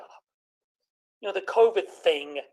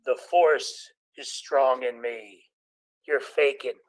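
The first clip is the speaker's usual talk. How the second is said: much lower, slow Jedi pronouncement; Vader-style reply; 6 semitones lower, much slower than usual. Retold or imitated. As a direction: lay down the force, slow and low. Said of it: "To which I say".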